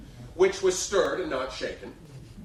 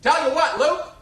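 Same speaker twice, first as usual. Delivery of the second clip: put-on much higher and louder star voice praising the kid; big brassy Broadway diva, generous; 12 semitones higher, 8 dB louder than usual. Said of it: "in that booming voice".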